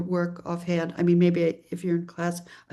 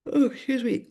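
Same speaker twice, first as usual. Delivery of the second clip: yawning; 5 semitones higher than usual. Real, not acted. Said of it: "I'm yawning because I'm talking so much".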